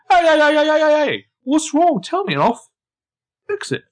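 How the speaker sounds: very high, loud hammed-up pleading; high mock Jordan. Podcaster voicing Jordan begging Hannah in a hammed-up squeak. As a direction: squeak out a panicked lover's plea.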